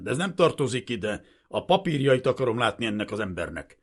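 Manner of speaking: loud, haughty demand; curt superior officer; overbearing. A narrator's reading. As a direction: brush the question aside and pull rank. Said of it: "recsegte fölényesen".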